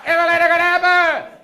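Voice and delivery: high, loud, fast garbled yell; hostile stranger again. Retold or imitated. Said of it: "I said, "What?".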